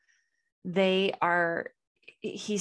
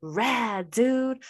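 laid-back surfer dude, pitched up; light imitation of a stoner surfer; 6 semitones higher, slower than usual. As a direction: mimic a chill surfer greeting a kid. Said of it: "they had this guy read this, like, surfer voice".

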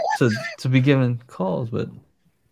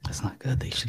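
whispering; 3 semitones lower, 12 dB quieter than usual. Real, not acted. Waiting on a live call.

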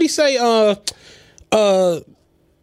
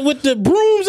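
usual speaking voice

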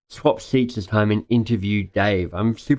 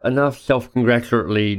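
usual speaking voice

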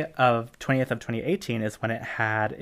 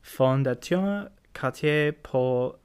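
slow, clumsy French pronunciation; stumbling attempt at French; bad French accent; 2 semitones higher, much slower than usual. Real, not acted. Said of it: "my French accent is the world's worst French accent".

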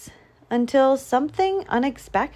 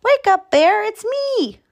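high, loud wake-up call; excited high-pitched hail; 13 semitones higher, 5 dB louder than usual. A narrator's reading. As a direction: call out to rouse a sleeper. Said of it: "he called".

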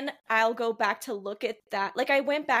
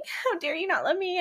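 higher-pitched, put-on outrage; mock indignation; 6 semitones higher than usual. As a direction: act hurt and offended, lightly. Acts testing fiancé.

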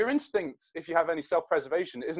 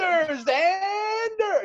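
high, drawn-out cry of recognition; very loud shout; much slower than usual. Acted. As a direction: holler the name for the whole airport. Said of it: "It's so loud".

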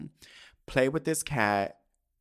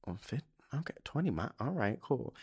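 softly going along with it, unconvinced; hushed, halting "okay then" reaction; muted acknowledgment; 9 dB quieter than usual. Retold or imitated. Woman replays hearing her date's commute, quiet noncommittal reply.

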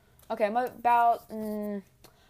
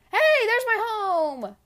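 excited; 13 semitones higher, 8 dB louder, much slower than usual. Real, not acted. Spotting home in the game.

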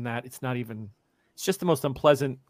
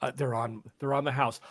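normal talking voice

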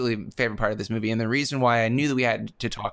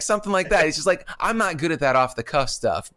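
ordinary talk

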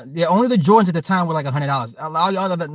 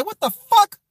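high-pitched, incredulous outburst; mock outrage, pitched way up; outraged mother; 13 semitones higher than usual. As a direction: imitate mom shocked at the price. Acting out mother's shock at price.

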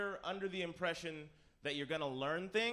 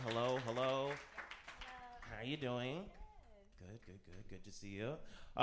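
ordinary talk, nothing marked

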